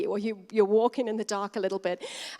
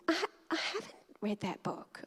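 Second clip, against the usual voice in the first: sheepish whisper; 5 semitones higher, 8 dB quieter than usual. Retold or imitated. Embarrassed admission to the woman.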